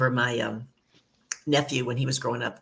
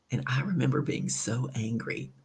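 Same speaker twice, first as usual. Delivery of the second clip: ordinary talk, nothing marked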